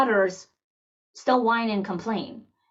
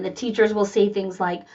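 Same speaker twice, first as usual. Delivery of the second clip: ordinary talk, nothing marked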